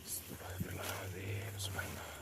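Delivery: scared whispered prayer under the breath; nervous night vlog whisper. Real, not acted. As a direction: whisper it, spooked and hushed. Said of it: "saya merinding banget".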